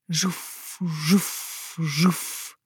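soft, airy whooshes; imitating a gliding paper plane. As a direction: whoosh like a little plane sailing by. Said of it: "deed het zwevende vliegtuigje na". (zoevend vliegtuigje nadoen)

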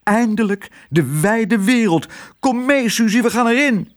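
high, thrilled mouse voice; squeaky excitement, eager to be off; delighted. A narrator's reading. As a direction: call out in rising delight, very high. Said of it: "riep hij verrukt".

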